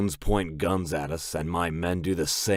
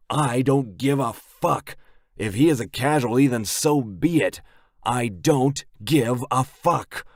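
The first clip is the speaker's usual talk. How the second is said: loud furious snarl; 6 semitones higher, 4 dB louder than usual. A narrator's reading. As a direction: spit it out in rage. Furious biker, enraged.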